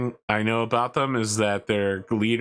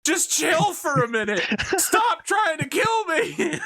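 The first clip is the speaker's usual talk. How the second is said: high, exasperated mock plea rising to a shout; high hammed-up exasperated pleading; pleading soldier; slower than usual. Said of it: "the dude just being like".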